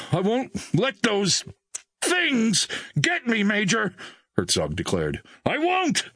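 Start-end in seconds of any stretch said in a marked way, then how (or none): 0.1-3.9 high, slow, strained vow; desperate defiance. A narrator's reading. Crewman tells the major those things won't get him, desperate. 5.5-6.1 very high, frantic refusal; desperate insistence. A narrator's reading. Crewman insists to the major he won't be taken, desperate.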